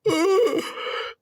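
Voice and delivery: sharp high gasp of amazement; astonished gasp. Retold or imitated. Hunter seeing the biggest deer of his life, gasping. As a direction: suck in air at the sight. First sight of a huge deer.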